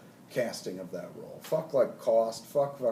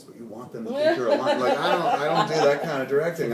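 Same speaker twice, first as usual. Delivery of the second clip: laughing; 4 semitones higher, 7 dB louder than usual. Real, not acted. Laughing at acting-method talk.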